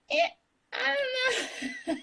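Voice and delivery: high, slow, drawn-out unsure whine; hesitant mock reluctance. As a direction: play the reluctant self, high and laughing. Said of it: "I was like".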